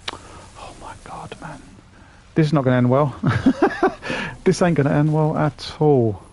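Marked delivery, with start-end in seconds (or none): 0.1-1.6 slow despairing whisper. Real, not acted. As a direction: breathe it out in quiet dismay. Dying in game.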